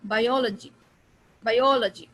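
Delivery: "Biology" with O punched loud; emphatic second syllable in "biology"; stress on O. Shown as a demonstration. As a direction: hit the O in "biology", then let it fall. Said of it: "बा, ओ पे".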